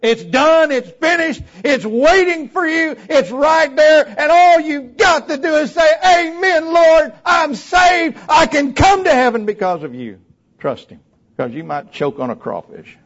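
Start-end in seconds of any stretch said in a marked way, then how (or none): 0.0-10.2 high, loud, shouted proclamation; fiery sermon climax; impassioned preaching. Real, not acted. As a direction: shout it out in pulpit fervor. Preaches at full force.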